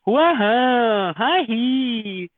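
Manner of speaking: a sing-song whining mimicry; mock whining. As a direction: parody a whining Korean voice. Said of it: "so it sounds like, uh, whining".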